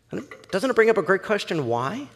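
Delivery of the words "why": usual speaking voice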